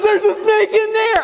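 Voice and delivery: high, frantic shout; panicked yell. Retold or imitated. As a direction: blurt it out in terror. Panicked manager in retold story.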